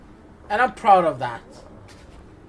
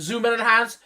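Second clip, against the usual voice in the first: mock crime-lab tech, slightly raised; CSI investigator; 4 semitones higher than usual. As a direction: parody a TV image-enhancing command. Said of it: "Fucking an episode of C S I?".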